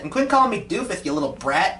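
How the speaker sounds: ordinary delivery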